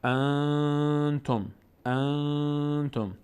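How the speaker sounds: انتم very slow with the n held nasally; drawn-out recitation of انتم. Shown as a demonstration. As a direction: stretch the ن in انتم through the nose.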